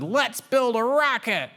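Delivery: high kitten cheer. A narrator's reading. Kittens setting off to build, high cheer. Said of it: "and said".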